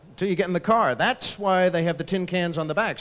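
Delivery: ordinary talk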